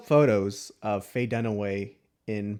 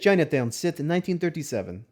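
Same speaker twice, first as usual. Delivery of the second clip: put-on loud, higher Russian accent voice; 6 semitones higher, 6 dB louder than usual. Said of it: "Let's see".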